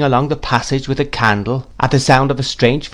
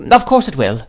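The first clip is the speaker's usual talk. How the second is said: higher, dismissive great-aunt; brisk aunt; 6 semitones higher, 3 dB louder than usual. A narrator's reading. Miss Betsy reassures mother about tea, brisk. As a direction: brush the doubt off briskly.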